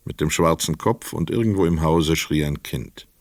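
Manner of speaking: normal talking voice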